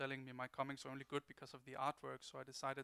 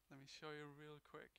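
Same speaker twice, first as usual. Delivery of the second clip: ordinary talk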